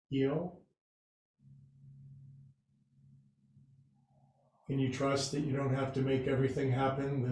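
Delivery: soft, low meditation voice; guided meditation. Acted. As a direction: ease the class into rest, gently and quietly. Leads the class in rest.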